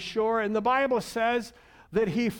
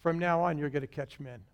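tender Jesus; 5 semitones lower, 5 dB quieter than usual. Acted. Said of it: "he says this".

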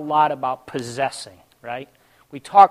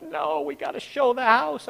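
higher-pitched mock protest; retold dismay; 8 semitones higher, 4 dB quieter than usual. Homeowner to himself before a showing, dismayed.

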